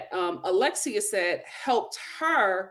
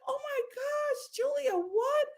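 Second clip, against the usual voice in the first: tiny high, soft squeal of disbelief; stunned reaction; 7 dB quieter than usual. Retold or imitated. Host reacting to Julia's opening, stunned and squealing. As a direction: squeak it out softly, overcome.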